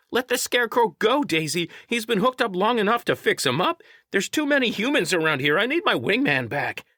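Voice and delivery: very high, insistent creature voice; high-pitched bossy sidekick; pushy little creature. A narrator's reading. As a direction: demand it in a high squeaky voice.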